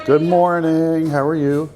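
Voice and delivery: high, slow sing-song hello; bright polite hello to staff; cheerful greeting. Real, not acted.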